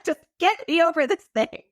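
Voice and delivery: high, half-laughing retold demand; mock pissed-off plea. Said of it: "I was so pissed".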